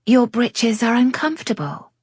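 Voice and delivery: light, high, polite concern; politely solicitous. Acted. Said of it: "in a politely solicitous tone".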